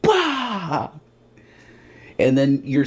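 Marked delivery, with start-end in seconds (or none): 0.0-0.9 high abrupt bang; bang noise. Retold or imitated. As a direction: spit out a crash sound. Impact noise.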